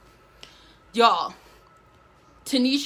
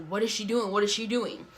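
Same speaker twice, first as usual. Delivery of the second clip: quiet puzzled murmur; 4 semitones lower, 8 dB quieter than usual. Retold or imitated. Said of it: "So we're like".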